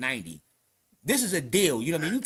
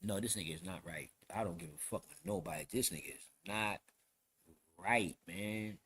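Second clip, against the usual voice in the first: low, soft, measured verdict; retold suspicion; 8 semitones lower, 8 dB quieter than usual. Inmate warning that the newcomer is not right, low and quiet. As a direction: lower and soften it, with pauses near the end.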